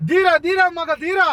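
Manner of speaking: high, shouted heroic line; film dialogue. Shown as a demonstration. Kidnapper demonstrating the line for her, shouted film dialogue. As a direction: belt it out like a movie punchline. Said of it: "ಒಂದ್ dialogue ಹೊಡೀರಿ".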